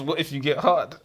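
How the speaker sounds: usual speaking voice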